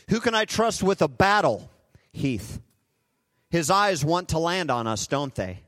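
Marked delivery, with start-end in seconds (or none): 2.1-2.6 soft answer. Acted. God answers softly by name.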